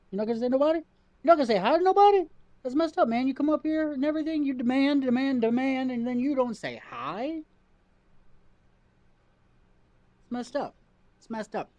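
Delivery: high, playful pet-talk scolding; mock scolding the cat. Real, not acted.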